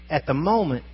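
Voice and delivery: normal talking voice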